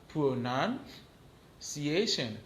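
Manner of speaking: slow, drawn-out Pronunciation; teacher modelling Pronunciation slowly; slow model word. Shown as a demonstration. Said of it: "Repete apre m konnen".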